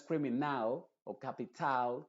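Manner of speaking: "criminal" y "capital" con A final clara; A muy abierta. Shown as a demonstration. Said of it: "con una A muy abierta". ("criminal" y "capital" sin reducir la A)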